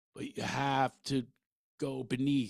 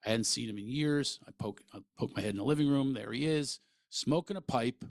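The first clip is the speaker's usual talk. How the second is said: ordinary delivery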